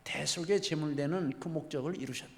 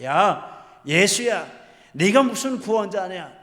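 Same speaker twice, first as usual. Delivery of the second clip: loud, raised-pitch taunting of a condemned robber; jeering shout; 4 semitones higher, 9 dB louder than usual. Retold or imitated. Robber mocking Jesus from the next cross, jeering shout. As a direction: shout mockingly, as if cursing him. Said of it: "이렇게 소리를 지릅니다".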